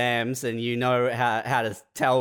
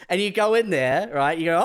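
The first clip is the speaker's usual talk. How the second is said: ordinary talk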